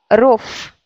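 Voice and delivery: "rof" ends in a voiceless F; "rof" with the V turned into F; final V as F. Shown as a demonstration. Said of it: "ça devient fait".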